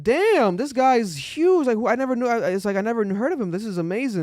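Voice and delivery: high, loud, stretched-out awe; amazed reaction. Retold or imitated. Host recalling discovering the rapper's huge views, high amazed outburst. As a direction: let it burst out high, amazed. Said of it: "And I'm like".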